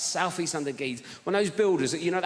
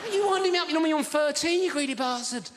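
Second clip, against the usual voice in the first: the comic scolding a builder, in mock outrage; 8 semitones higher than usual. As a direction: shout it at the builder, pretending to be offended.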